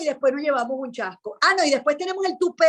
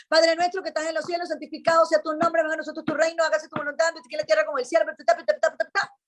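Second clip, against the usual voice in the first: rattled off in an unbroken run; recited without feeling; 2 semitones higher, faster than usual. Shown as a demonstration. Showing how we are taught the prayer, recited without feeling. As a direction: recite it by rote, trailing into ta-ta-ta. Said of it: "pero sin sentirla".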